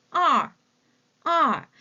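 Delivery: 알 said loud as Mandarin èr; model pronunciation. Shown as a demonstration. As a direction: call out 알 firmly for repetition. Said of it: "teacher models number two".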